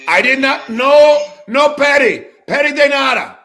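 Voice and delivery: high, loud refusal in mock Spanish; yelling. Real, not acted. Streamer refuses prank food delivery, yelling. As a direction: yell the refusal at the door. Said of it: "[name]'s yelling at".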